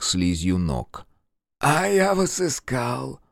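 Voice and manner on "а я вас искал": high, slow, eager monster voice; drawn-out and hopeful, high-pitched; eager creature voice. Acted. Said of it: "снова сказал грех".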